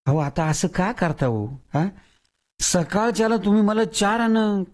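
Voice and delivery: a worker begging his employer to pay as promised; pleading whine; whining voice. A narrator's reading. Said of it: "किंद्र्या आवाजात म्हणाला".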